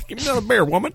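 high fast yokel holler. Acted. Echo of redneck bit.

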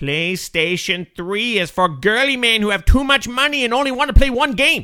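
rude foreign guest in broken English; broken-English foreign character. Acted. Comedy guest mocking the host, broken-English accent. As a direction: insult the host in a thick put-on accent.

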